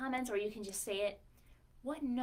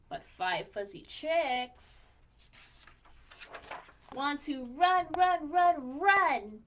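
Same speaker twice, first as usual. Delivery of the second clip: slow start rising to a much louder, higher run run run; building excitement; 4 semitones higher, 11 dB louder, much slower than usual. A narrator's reading. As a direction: build it up until the chicks burst away. Chicks running off, building.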